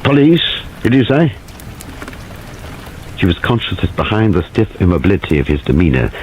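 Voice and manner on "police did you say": clipped, short and sharp; staccato question, a little higher. A narrator's reading. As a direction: bite each word off short. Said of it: "in a short, staccato voice".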